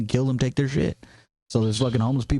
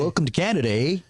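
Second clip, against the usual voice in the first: Canadian impersonation. Pretend. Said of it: "do the Ontario, Canada impersonation".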